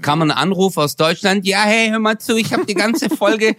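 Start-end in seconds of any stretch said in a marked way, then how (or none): 1.5-3.6 high whiny voice with a slushy lisp. Retold or imitated. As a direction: imitate a lisping crybaby partner. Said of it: "wie du mich als heulende, lispelnde Lusche nachmachst".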